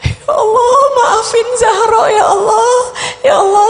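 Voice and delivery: high, crying plea to God, voice breaking; crying repentant prayer, rising into cries; tearful pleading prayer. Retold or imitated. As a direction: sob out the apology as the mother who missed night prayer. Daughter retells mother's dawn regret.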